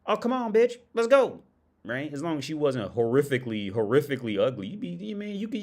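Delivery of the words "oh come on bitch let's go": high, loud eager yell; eager man. Retold or imitated. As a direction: jump at the chance, loud and high. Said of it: "he'd be like".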